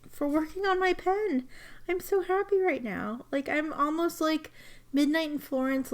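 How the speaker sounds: almost tearing up with gratitude; overjoyed, near tears. Real, not acted. Thanking Gina for the repaired pen, high and overjoyed. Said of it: "I'm tearing up".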